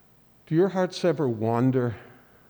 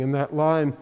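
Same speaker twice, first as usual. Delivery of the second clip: usual speaking voice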